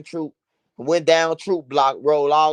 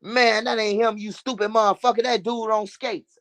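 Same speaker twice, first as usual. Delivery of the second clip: higher scolding voice; voicing the big homie; 6 semitones higher than usual. Big homie scolding his crew, higher mock scold. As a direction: scold the stupid friend, pitched up. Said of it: "He was like".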